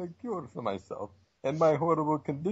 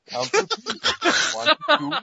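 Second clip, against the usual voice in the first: mock high counting; 11 semitones higher, 4 dB louder than usual. Villain taunts Spider-Man, squeaky high mock counting.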